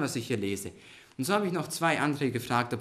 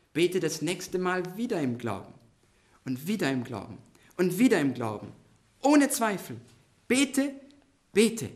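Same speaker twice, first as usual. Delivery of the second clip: high, emphatic exhortation building in force; urgent pulpit appeal; impassioned preaching; 6 semitones higher, 4 dB louder than usual. Real, not acted. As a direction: drive each repeated call home, rising to loud, high commands.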